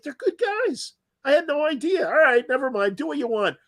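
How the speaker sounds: high-pitched imitation of a father conceding; quoted accepting dad. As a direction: pitch it way up, waving off old objections. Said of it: "and he's like".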